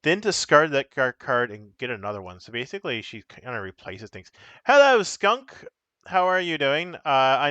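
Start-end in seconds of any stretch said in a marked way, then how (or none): 4.6-6.9 cheery greeting. Real, not acted. Greets a viewer.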